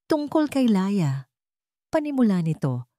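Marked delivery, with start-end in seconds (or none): 0.1-1.3 slow, weighty, concerned opening; slow worried hint. Acted. Clarita to Gideon, bringing up Laya, slow and worried. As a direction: drop the name slowly like bad news. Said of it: "Ang boses nito ay puno ng pag-aalala".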